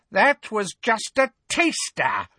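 high, amused witch voice; toying, cruelly light. A narrator's reading. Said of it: "said Bellatrix".

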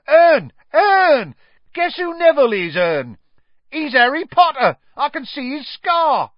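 high, loud cockney calling; excited shouting. Acted. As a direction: call out, thrilled. Stan calling to Erne.

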